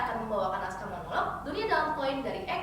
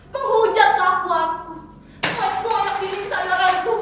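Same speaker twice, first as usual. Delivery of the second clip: loud, high-pitched charges; dramatic raised-voice protest; anguished accusation; 5 semitones higher, 7 dB louder than usual. Acted. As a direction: hurl each charge loudly. Listing the book's assaults.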